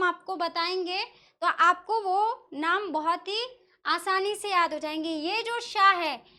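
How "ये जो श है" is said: slowly draws out the श in "ये जो श है"; drawn-out sh. Shown as a demonstration. Teacher slowly showing the palatal श to students. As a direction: stretch the श in "ये जो श है" to show the sound. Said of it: "जिसको आप शरीफा वाला सा कहते हो".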